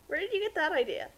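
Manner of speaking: ordinary talk, nothing marked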